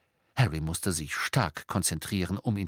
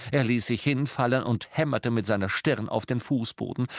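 usual speaking voice

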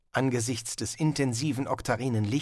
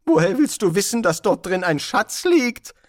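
sceptical wizard; 8 semitones higher, 7 dB louder than usual. Acted. Wizard challenges the barbarian.

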